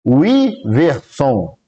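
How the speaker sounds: "Wiverson" said very slowly, every syllable drawn out; slow spelled-out name. Shown as a demonstration. Reading the viewer's name aloud to chat, slowly. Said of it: "Lê assim".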